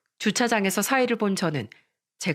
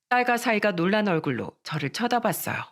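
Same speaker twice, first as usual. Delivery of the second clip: usual speaking voice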